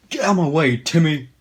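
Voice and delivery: hammed-up shove-past yell; mock shout. Teacher shoving kids aside.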